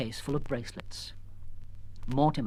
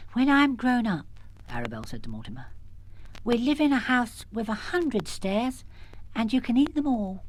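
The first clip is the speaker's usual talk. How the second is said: put-on a little girl promising her pet; small child; 7 semitones higher than usual. Arabelle promising Mortimer, small and dreamy.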